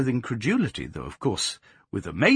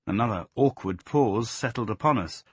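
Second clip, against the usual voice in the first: ordinary delivery, nothing marked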